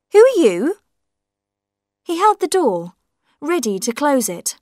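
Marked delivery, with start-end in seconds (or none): 0.1-0.8 higher, louder, alarmed; startled question. A narrator's reading. Man at the door asks a stranger, startled and loud. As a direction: ask it in shock.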